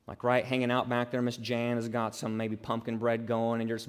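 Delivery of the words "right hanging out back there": ordinary talk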